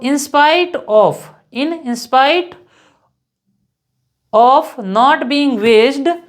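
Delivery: very slow, word by word, spacing each phrase; slow dictation. Shown as a demonstration. Teacher dictating an example sentence to students, very slow. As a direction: spell it out slowly for students to copy. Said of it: "dictating the phrase slowly".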